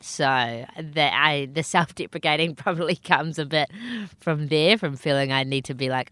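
ordinary talk

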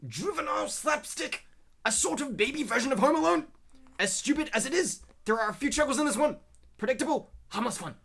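high-pitched mock yell, read out in caps. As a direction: read it like yelling in someone's face. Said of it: "just imagine I'm yelling this in your face".

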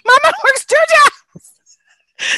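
high, slow and loud, cracking up; laughing repeat. Retold or imitated. Repeating her childhood line to friends, laughing hard. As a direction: squeeze it out while laughing.

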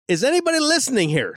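high-pitched, quick questioning call; mock plea for any listeners. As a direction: call out as if to an empty room. Acting out podcaster wondering about listeners.

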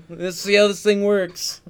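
put-on slow, loud thick Boston accent. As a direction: drag it out like a thick Boston guy. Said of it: "super thick Boston accent".

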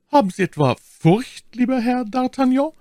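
high-pitched, scornful lady's taunt; cutting, mocking. A narrator's reading. My Lady taunts D'Artagnan about being afraid, cutting and scornful. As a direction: needle him in a high, cutting voice. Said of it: "sprach sie in einem schneidenden, höhnischen Tone".

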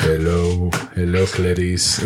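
slow, low robotic greeting; measured machine-like hello. Pretend. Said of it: "He talks to himself in the robot voice".

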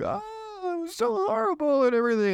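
high, wailing fake cry; mock sobbing. Heartbroken friend crying over a breakup, high mock wail.

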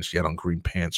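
usual speaking voice

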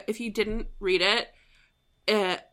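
ordinary talk, nothing marked

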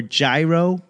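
"gyro" said as JAI-roh; "gyro" starting like the word "jive"; pronunciation variant. Shown as a demonstration. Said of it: "however the fuck you pronounce it".

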